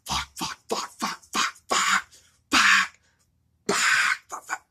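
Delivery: acting out a badly hurt man, voiceless bursts; stuck on one word. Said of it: "that's the only word he can get out".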